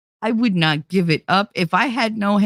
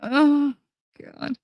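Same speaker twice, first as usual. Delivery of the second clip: upset but laughing; 9 semitones higher, much slower than usual. Real, not acted. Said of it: "I was so upset".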